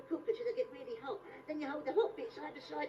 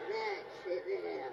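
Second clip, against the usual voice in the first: mock seductive murmur; 10 semitones lower, 5 dB quieter, slower than usual. Coaxing in low voice.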